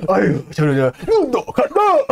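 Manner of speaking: very high, slow, laughing through it; old Paddy groaning again, played for laughs, slow and high; laughing old-man groan. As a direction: stretch it out as a joke. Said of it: "이러면서 훅 하고".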